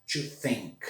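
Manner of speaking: "think" with a breathy TH; "To think" with the tongue between the teeth; unvoiced TH. Shown as a demonstration. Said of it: "Outra forma de falar o T H".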